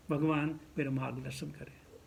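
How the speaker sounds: low, hushed plea; acted prayer. Devotee asks God for guidance before acting, acted quiet prayer. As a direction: drop low and soft to ask.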